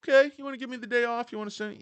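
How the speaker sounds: high, fast eager trader voice; mock trader reply. Vlogger voicing traders thanking their boss, high quick chirp. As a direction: chirp it like a grateful underling. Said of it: "And they're like".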